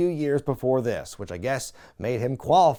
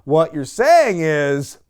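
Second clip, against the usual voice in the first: very slow, louder, with heavy pauses; slow pointed hint; 6 dB louder, much slower than usual. Retold or imitated. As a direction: spell out the hint word by word. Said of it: "MVP again is like".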